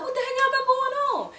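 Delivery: high, exasperated put-on dad voice; dad's voice. Dad asks his daughter what happened, exasperated high mock voice. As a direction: play the baffled dad demanding answers.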